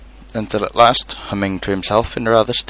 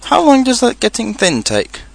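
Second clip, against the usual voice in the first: high, worried question; a touch of dread in the asking; anxious; 12 semitones higher than usual. A narrator's reading. Pooh asking how long he is stuck, anxious. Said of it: "asked Pooh anxiously".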